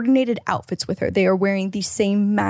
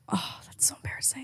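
whisper; 3 semitones higher, 17 dB quieter than usual. Real, not acted. Cringing at the story.

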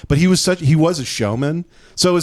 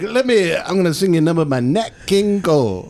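put-on higher-pitched old showman's stage patter; 4 semitones higher than usual. Comic mimicking a singer introducing his number, showman patter. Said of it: "he would just be like".

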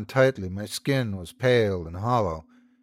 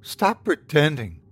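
controlled but shaky; 4 semitones higher than usual. Acted. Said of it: "her tone controlled but shaky".

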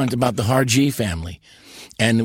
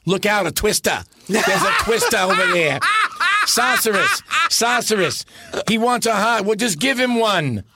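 imitating a Broadway Dorothy with a New York accent, laughing; 9 semitones higher than usual. Friend imitating Dorothy's New York accent, high and laughing. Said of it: "her accent was like".

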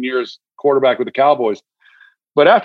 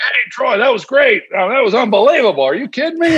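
imitating Jaws, much higher and fast; excited colleague; 9 semitones higher than usual. Jaworski raving to Troy after the game, high excited gushing. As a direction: gush about the game like a thrilled colleague. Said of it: "Jaws is all excited about the game".

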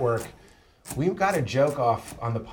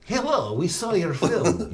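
slow, higher mock Eastern European mobster; mock mafia accent; 5 semitones higher, slower than usual. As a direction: drawl it like a movie gangster. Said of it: "the mafia is gonna come and be like".

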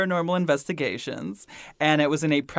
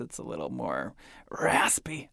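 "It's a little more raspy." whispered; whispering; 5 semitones lower, 8 dB quieter than usual. Real, not acted. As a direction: breathe it out unvoiced. Hushed aside before ghost clip.

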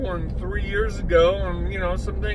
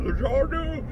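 higher, exaggerated mocking Zhanu; 8 semitones higher, 4 dB quieter than usual. [name] mocks the doctor's word again, higher. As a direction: sneer the name again. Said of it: "Are you mocking Zorth".

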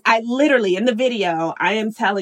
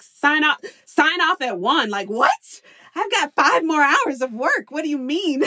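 higher, disbelieving; incredulous what; 7 semitones higher than usual. Retold or imitated. Working mom hearing school ends early, higher and incredulous. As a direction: react in disbelief. School surprise sign-off at one.

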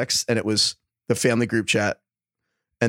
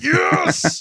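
a sudden high shout of triumph; a big high, loud "Yes!"; excited yell; 4 dB louder than usual. Retold or imitated. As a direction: yelp it out like you just won. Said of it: "And I was like".

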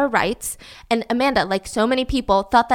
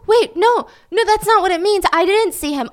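high-pitched hurried protest; raised, insistent denial; flustered denial; 8 semitones higher, 4 dB louder than usual. Retold or imitated. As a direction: act out a suspect scrambling to explain. Acting suspect's flustered denial.

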